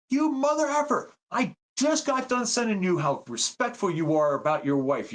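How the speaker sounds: high, indignant mock outburst; high mock rant at the speaker; outraged yelling. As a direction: yell at the radio, betrayed. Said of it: "He kept going on".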